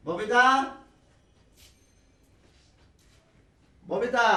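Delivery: repeated call with a lift at the end. Acted. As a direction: keep calling for someone who won't come. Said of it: "এত চিৎকার করছো কেন".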